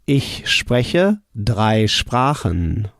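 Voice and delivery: slow "Ich spreche drei Sprachen"; careful teacher pace; slow model answer. Shown as a demonstration. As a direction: say it clearly for the learner to copy. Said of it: "How would you say".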